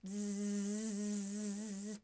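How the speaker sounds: long steady bee buzz, zzz held out; buzzing bee noise. Shown as a demonstration. Teacher buzzing like a bee for children.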